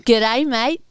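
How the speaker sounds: a quick jokey Aussie "G'day"; Australian accent. Pretend. Friend teasing about moving abroad, mock Australian accent. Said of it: "moderately racist to the Australians there".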